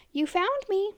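high, menacing monster voice; sinister voice. Acted. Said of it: "it said in a sinister voice".

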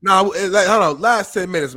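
loud, pushy urging; loud and impatient. Real, not acted. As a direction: raise your voice, losing patience. Host pushing chat, annoyed.

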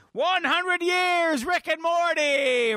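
very high, drawn-out cartoon cheer; hammed-up slow proclamation; Rick and Morty. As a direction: stretch each word into a high mock chant. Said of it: "Rick and Morty say".

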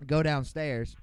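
ordinary delivery

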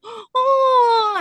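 high, loud scandalized gasp; mock-shocked high "oh"; imitated shocked grandmas. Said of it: "grandmas looking at me like".

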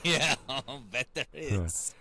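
dragged-out knowing reply, voice changed; slow wry aside. Retold or imitated. Son's knowing slow reply to mom's remark. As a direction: drawl it slowly, with a wink. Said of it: "And I'm like".

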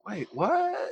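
high, puzzled wait what; stunned double take; confused surprise. Retold or imitated. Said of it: "looking at her like".

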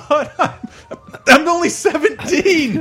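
high, loud pleading through laughter; laughing plea. Retold or imitated. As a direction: plead your age while cracking up.

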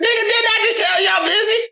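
hammed-up snap at the girlfriend; mock angry outburst. Man yelling at his girlfriend in a retold call, mock angry shout.

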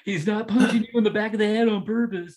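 high, loud mock pleading; friends vouching. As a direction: plead his case for him. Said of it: "all my friends were like".

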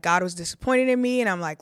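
ordinary talk

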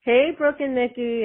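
ordinary talk, nothing marked

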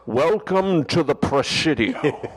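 slow, grand Scottish Connery line; Connery movie line. Pretend. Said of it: "that was Sean Connery".

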